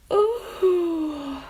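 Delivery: high stretching yawn; a big waking yawn; yawning ooh. Acted. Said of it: "as she stretched and yawned".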